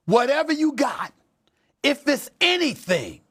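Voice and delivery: high, forceful declaration; emphatic demand. Real, not acted. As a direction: punch it out high, demanding total effort. Host's emphatic demand to injured player.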